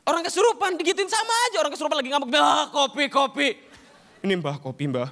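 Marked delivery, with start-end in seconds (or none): 2.3-3.5 loud demanding possessed voice; possessed grump. Acted. Possessed man demanding coffee, loud sulky call. 4.3-5.1 meek helper. Acted. Helper offering coffee to the spirit, low coaxing.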